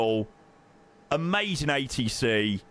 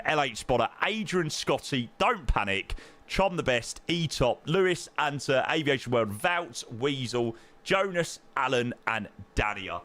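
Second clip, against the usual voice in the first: normal talking voice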